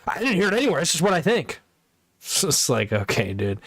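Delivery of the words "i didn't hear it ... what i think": high-pitched mimicry of a defensive guy; mock defensive denial. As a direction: imitate him denying it, voice up high.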